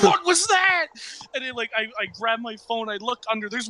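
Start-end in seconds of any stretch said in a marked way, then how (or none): 0.0-0.9 high alarmed "what was that"; jolted awake and asking in alarm; startled. Retold or imitated. Brother waking up startled at a crash, high and alarmed.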